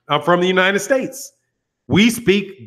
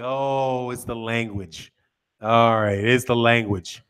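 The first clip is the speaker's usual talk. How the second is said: low, soft, resigned acceptance; quiet, deep-voiced "I get it now"; dawning realization; 8 semitones lower, 5 dB quieter, slower than usual. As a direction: drop low as it sinks in. Retelling his dawning realization.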